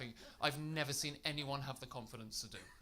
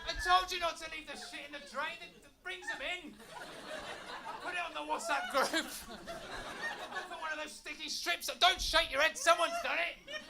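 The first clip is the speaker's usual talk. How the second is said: hammed-up panic over a seal in the kitchen; mock panicked household; slower than usual. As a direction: shout like a flat full of seals.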